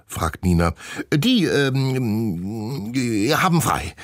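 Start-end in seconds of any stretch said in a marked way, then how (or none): 1.1-3.9 hesitant high stammer. A narrator's reading. Director stammers when asked about the animals, hesitant. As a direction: caught out, stumbling over the words. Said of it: "stottert Doktor Murks".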